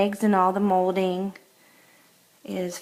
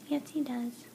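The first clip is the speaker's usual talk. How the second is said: whispering; 4 semitones higher, 8 dB quieter than usual. Real, not acted. Whispering about dog.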